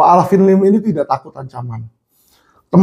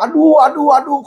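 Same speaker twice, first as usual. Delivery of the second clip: imitated pain cries; 8 semitones higher than usual. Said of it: "ada orang di situ teriak-teriak".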